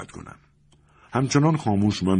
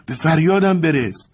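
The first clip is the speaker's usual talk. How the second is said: high, louder plea for rescue; desperate cry for help; 11 semitones higher, 5 dB louder than usual. A narrator's reading. Man in a struggle outside, crying high for help. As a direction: cry out for rescue, pitch leaping up. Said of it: "صدای خفه دوباره منعکس شد".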